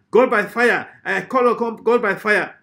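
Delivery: high, loud imitation prayer cry; mock-commanding prayer shout; mock fire prayer. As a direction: parody a preacher calling down fire. Parodies prayer commanding God.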